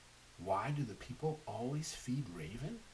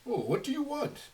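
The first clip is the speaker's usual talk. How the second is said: high, louder mother's voice; a higher, louder mother asking her crying child; 13 semitones higher, 8 dB louder than usual. Acted. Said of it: "asked his mother".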